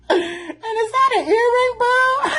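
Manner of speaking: high, laughing mock shout; mocking the drawing's hairdo and earring, laughing hard; laughing in disbelief. Real, not acted. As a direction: shriek it while cracking up.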